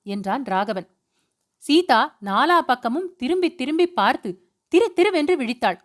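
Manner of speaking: ordinary delivery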